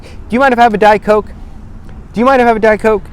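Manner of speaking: high, bright polite ask; polite request. Shown as a demonstration. Ordering at a bar, bright and high. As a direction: ask sweetly and easily. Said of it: "off your tongue".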